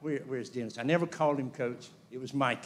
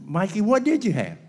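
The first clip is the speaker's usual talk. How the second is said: higher, louder exasperated question; louder mock disbelief; 7 semitones higher, 7 dB louder than usual. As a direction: demand it in disbelief.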